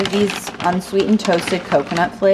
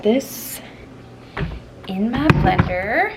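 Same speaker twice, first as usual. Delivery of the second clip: slow, drawn-out Southern accent; slower than usual. Real, not acted. Vlogger telling viewers where the food goes, slipping into a Southern accent. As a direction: slip into a Southern drawl for a moment. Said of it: "said that with a southern accent".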